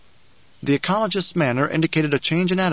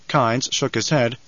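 plain reading voice

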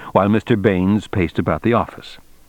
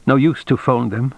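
plain reading voice

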